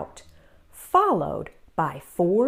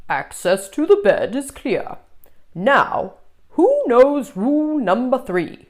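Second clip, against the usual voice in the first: louder lecturing monster voice; stern monster teacher reciting rules; 5 semitones higher, 5 dB louder than usual. Acted. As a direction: recite the rule like a strict teacher. Monster teacher quizzes class.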